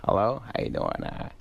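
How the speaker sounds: mumbled impression of a phone greeting; Adam Sandler. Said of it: "Adam got on the phone".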